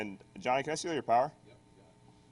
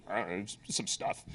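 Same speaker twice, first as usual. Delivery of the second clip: much softer, lower, offhand mumble; mock offhand shrug; 4 semitones lower, 11 dB quieter than usual. Hacker brushing off his phone trick with a soft, low shrug. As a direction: toss it off like it's nothing. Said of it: "He's like".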